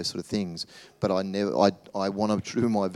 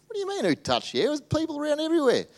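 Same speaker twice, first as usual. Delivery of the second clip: high, fast disbelief; incredulous disciples; faster than usual. Acted. Disciples scoffing at Jesus's question in the crowd, high and incredulous. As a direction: play the disciples, baffled by the question.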